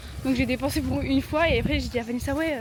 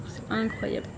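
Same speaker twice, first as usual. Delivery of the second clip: awed whisper; 4 dB quieter than usual. Real, not acted. Amazed at photos.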